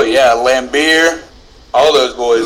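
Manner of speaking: ordinary talk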